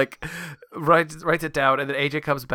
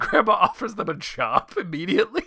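usual speaking voice

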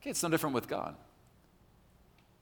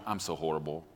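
low, soft mock woe-is-me; self-pitying; 8 semitones lower, 7 dB quieter than usual. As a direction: mope it out quietly, mocking self-pity. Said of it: "Woe is me".

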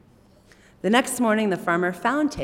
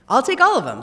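eager loud reply; 8 semitones higher, 11 dB louder, faster than usual. Acted. Farmer answers eagerly.

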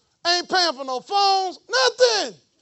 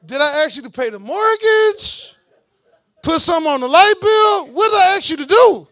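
louder, higher mock scolding of a lazy kid; a parent tells the child off for refusing dishes, scolding loudly; 4 semitones higher, 10 dB louder than usual. Parent scolds child over chores.

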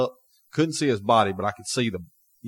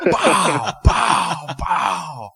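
pow pow pow, higher, half-laughing; laughing gunshot sounds; 6 semitones higher, much slower than usual. Retold or imitated. Hunter retelling firing at the deer, laughing gunshot noises. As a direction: mimic pistol shots through a laugh.

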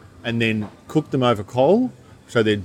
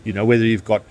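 normal talking voice

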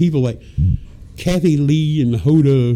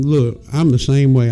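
ordinary talk

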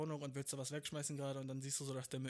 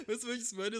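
laughing through the question, higher; 7 semitones higher, 6 dB louder than usual. Real, not acted. Vlogger laughing while asking viewers. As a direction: still giggling at the story. Laughing after acted quarrel.

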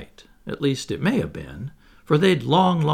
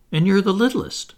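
higher, louder, a little tart; almost tartly; 8 semitones higher, 6 dB louder than usual. A narrator's reading. Wendy telling Michael why he is baby, almost tartly. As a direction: settle it with mild sharpness. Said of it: "she said almost tartly".

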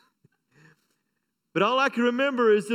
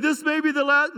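dramatic farewell; 7 semitones higher, 4 dB louder than usual. Retold or imitated. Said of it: "And I said".

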